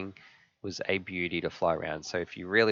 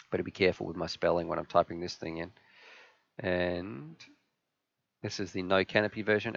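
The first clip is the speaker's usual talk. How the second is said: ordinary talk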